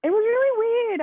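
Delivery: loud, very high-pitched insistence; emphatic high exclamation. Real, not acted. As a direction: raise it high and loud over the talk. Emphatic repeat while both talk.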